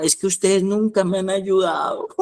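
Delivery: high, whiny voice of a son who says he's never been helped; whiny son's complaint. Retold or imitated. Preacher mocks a son's phone complaint, high and whiny. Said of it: "Y lloraba así".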